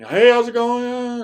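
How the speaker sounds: high, drawn-out call to someone hard of hearing; acted loud greeting. Hunter greeting an old farmer at his door, loud high mock call.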